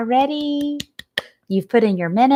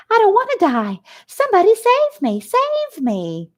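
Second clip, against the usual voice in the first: high, pleading pig scream; panicked scream; 12 semitones higher than usual. Acted. Terrified pig Wilbur begs someone to save him. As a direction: beg for rescue in terror. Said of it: "Wilbur screamed out".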